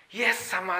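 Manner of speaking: ordinary talk, nothing marked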